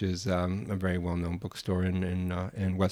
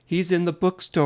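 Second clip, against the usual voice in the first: insistent Italian woman; 10 semitones higher, 6 dB louder than usual. Retold or imitated.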